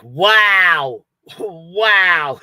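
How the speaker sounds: loud, drawn-out mock wow; sleeper's wow. Imitating her blurting wow without knowing it, loud and drawn out. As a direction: blurt a big wow, laughing between. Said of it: "Or she'll be like".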